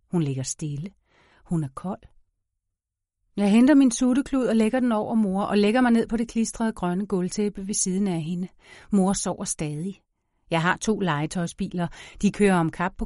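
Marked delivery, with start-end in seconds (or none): none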